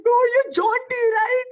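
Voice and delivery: very high little-fan voice; imitated tearful girl. Small girl recognising the actor, imitated tearful excitement. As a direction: squeak it, on the edge of tears. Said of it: "with tears in her eyes".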